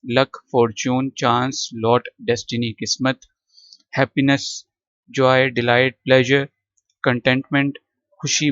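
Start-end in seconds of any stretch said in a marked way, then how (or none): none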